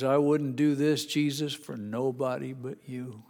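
normal talking voice

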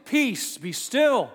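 high, loud command to the storm; a booming rebuke of the wind; commanding call. Acted. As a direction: order the sea to be still.

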